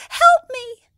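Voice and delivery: very high, loud cry of a magic harp; calling harp. Acted. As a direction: call out for help in a tiny high voice. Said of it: "called the harp".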